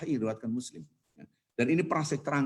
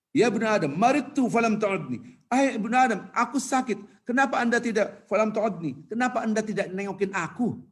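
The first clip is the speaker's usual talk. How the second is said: raised-pitch preacher's declamation; God questioning mankind; 6 semitones higher than usual. Acted. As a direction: question humanity as the divine voice. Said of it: "Allah berkata kepada hamba-hambanya".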